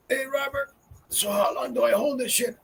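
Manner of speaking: high, squeezed voice on held breath; strained, high and soft, not exhaling; holding breath in. Retold or imitated. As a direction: imitate talking with lungs full of smoke. Acts holding in a big hit.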